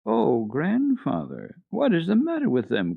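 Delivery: put-on girl dismayed at her withered flowers; shocked cry. A narrator's reading. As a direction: cry out, upset by the wilted flowers. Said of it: "cried Heidi, quite shocked".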